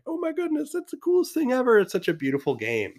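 high-pitched, awestruck gushing; re-enacted wonder, starting very high and easing down; gushing delight. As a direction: gush like a thrilled fan, pitched high. Acts out delight at holding game.

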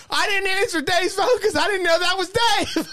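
high-pitched, hammed-up protest; frantic excuse. [name] explaining the unanswered call, in mock frantic protest. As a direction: blurt a defensive excuse way up high.

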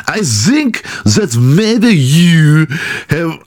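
high, very slow botched German slipping toward French; terrible German accent. Acted. Comedian retrying the fake therapist's reply, botched German accent. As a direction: drag out a badly faked German accent. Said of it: "I can't do a German accent".

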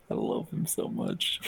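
whispered aside. Real, not acted.

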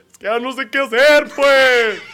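high, loud mock wail; hammed-up sobbing shout; loud hammed-up northern man wailing; crying like a Sonoran. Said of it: "Lloro así de".

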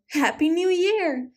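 sing-song high reply; called out. A narrator's reading. Animals answering each other, high gleeful call. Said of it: "they called to each other".

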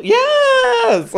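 high, loud, drawn-out whoop; excited cheer. Real, not acted. Cheering a co-host's next drink, excited.

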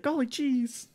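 high-pitched cartoon-like exclamation; Morty. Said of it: "you sound like Morty".

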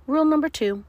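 loud, high teacher voice; bossy monster teacher; stern teacher monster. Acted.

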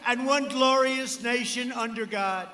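usual speaking voice

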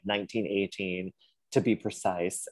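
usual speaking voice

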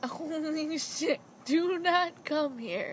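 slow, unbothered imitation of a yell; lazy non-yell imitation; calm mock yell. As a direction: drawl the warning lazily, no real shout. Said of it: "even his yelling isn't, like, yelling".

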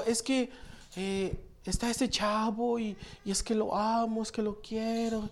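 soft, breathy-free hushed imitation of a smitten teenager; quiet mock teen girl pleading; lovesick teen girl. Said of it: "venían señoritas y me pedían consejo".

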